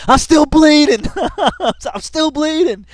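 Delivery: high, loud whiny victim voice; mock cries of a bleeding man; mock whimper. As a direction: imitate a wounded man pleading. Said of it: "talking to the E M S".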